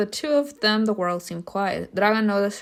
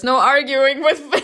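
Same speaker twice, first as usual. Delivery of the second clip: higher, louder, tipping into real laughter; laughing; 5 semitones higher, 7 dB louder than usual. Real, not acted. Player gives in to the romance twist, laughing. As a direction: declare it, then crack up.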